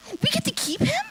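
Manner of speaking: put-on girl thrilled at the chance to keep the puppy; excited exclamation. A narrator's reading. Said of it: "Riley exclaimed".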